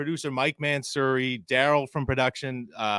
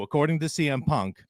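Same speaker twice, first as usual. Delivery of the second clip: ordinary talk, nothing marked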